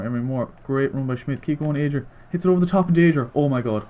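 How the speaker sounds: ordinary talk, nothing marked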